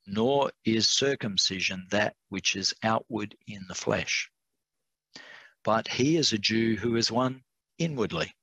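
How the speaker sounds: deliberate scripture reading, phrase by phrase; careful, unhurried reading with measured pauses; slow reading. Real, not acted. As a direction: read slowly, pausing between the clauses. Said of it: "I have to read this slowly".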